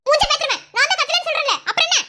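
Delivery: wife angrily denying to her husband that she screamed; angry denial. Acted. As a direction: snap back furiously.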